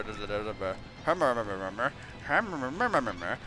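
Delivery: mock mumbling hum.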